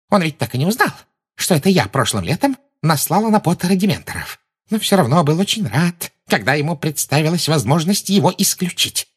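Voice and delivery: lightly voiced sugary villain; a hint of a purring tyrant; sweet sinister witch. A narrator's reading. Umbridge voiced in story. (слегка слащавая злодейка)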